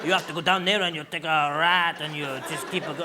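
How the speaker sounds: hammed-up French-accented English; lightly mock-French delivery; slight French accent. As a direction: give directions in a put-on French accent. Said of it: "with a slight French accent".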